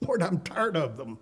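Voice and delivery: a lightly acted weary sigh of a complaint. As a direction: let Moses sound worn out and fed up. Moses complaining to God, weary.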